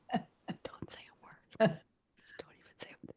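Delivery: hushed, breathy-free whisper of embarrassment. Real, not acted. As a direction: whisper it like a secret. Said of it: "That's that flirt".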